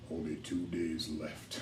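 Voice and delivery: low, hushed, slow warning; ominous voice in heads. Acted. Game master as the voice in everyone's heads, ominous. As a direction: intone it slowly and darkly, very softly. Voice heard inside the characters' heads.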